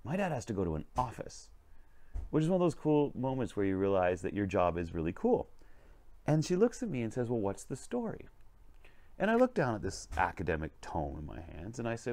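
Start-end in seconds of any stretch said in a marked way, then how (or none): 0.0-1.5 put-on higher-pitched childlike amazement; wide-eyed little girl. Little girl amazed at reading books for work, wide-eyed. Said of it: "her eyes get really big". 7.3-8.3 put-on higher, lightly childlike question; a hint of an inquisitive kid, pitched up; little girl. Little girl asking what the book's story is, lightly curious. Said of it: "this little girl comes in".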